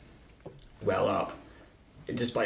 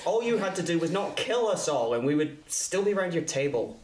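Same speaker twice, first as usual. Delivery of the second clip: raised, higher, throwing it back; angry retort; 8 semitones higher than usual. Acted. Mason throws Vicky's words back, angry and raised. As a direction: hurl her words back at her.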